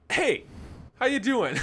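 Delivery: high, bright-as-can-be mock hello; butter up the salesman with a fake cheer; fake cheery greeting.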